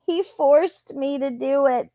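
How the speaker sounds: high, whiny mock imitation of her; mocking her words to the cops. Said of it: "do you remember when she told the cops".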